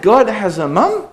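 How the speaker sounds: high, rising puzzled question; incredulous childish query; childlike disbelief. Retold or imitated. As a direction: echo the child's baffled thought. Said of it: "I'm thinking in my head".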